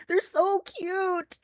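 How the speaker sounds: fawning over cute creatures, slow high coo; high, slow gushing. Real, not acted. Streamer doting on cryptid videos, slow high coo. Repeats it slower, higher, gushing.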